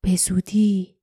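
voiceless whispered promise; ominous whisper. Acted. An unseen presence promising it is coming soon, whispered. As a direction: whisper it like a secret threat. Secret voice whispers during confrontation.

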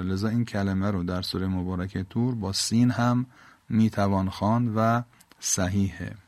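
ordinary talk

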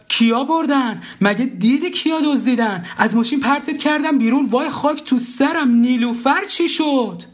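very high-pitched outcry; high, frantic wife, drawn out at the end; acted panicked shout. A narrator's reading. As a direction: cry out in escalating alarm. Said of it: "داد زد".

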